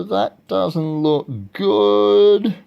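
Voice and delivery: high, very slow, loud storytime dismay; drawn-out dismay. Pretend. Reader reacting to the eagle's hiding place, drawn-out dismay. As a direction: stretch it out in exaggerated worry.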